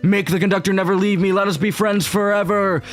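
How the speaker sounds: desperate, betrayed friend. Acted. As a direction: beg theatrically for your wish. Plays desperate wisher in game.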